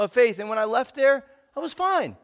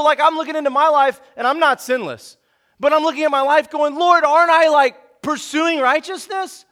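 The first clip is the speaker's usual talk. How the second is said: loud, fervent sermon delivery; preaching at full force; 4 semitones higher, 7 dB louder than usual. Acted. Pastor driving home the climax, full preacher force.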